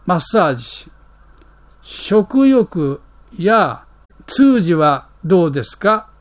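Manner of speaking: ordinary talk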